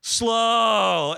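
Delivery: drawn-out, exaggerated slog, last word long and loud; dragging weary complaint. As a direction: groan it out, stretching the big words. Acting out dragging work weeks.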